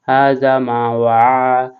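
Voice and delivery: very slow, drawn-out tilawat; slow recitation. Acted. Teacher draws out the words for students, slowly. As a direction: stretch each syllable.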